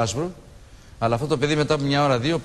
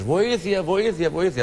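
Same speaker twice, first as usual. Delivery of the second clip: mild mock cry for help, pitched higher; retold cries for help; 6 semitones higher, much slower than usual. Survivor recalling drowning men crying for help, a light high-pitched call. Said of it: "ακούγαν πολλές φωνές".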